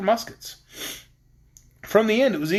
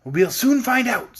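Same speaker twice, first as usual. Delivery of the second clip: sly; 6 semitones higher, 3 dB louder, slower than usual. A narrator's reading. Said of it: "said the Wizard with a sly laugh".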